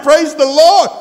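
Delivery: shouted high, rising to overcome cries of glory; ecstatic preaching. Real, not acted. Preacher reliving his blessing in the car, shouting with joy. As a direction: let the joy burst out loud and high.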